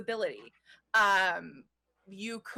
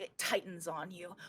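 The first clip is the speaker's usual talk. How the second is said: ordinary talk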